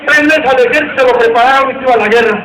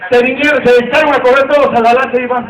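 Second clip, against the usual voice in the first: normal talking voice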